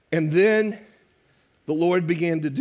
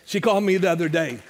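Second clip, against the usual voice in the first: normal talking voice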